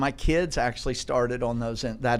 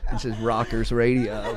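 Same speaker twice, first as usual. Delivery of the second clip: ordinary delivery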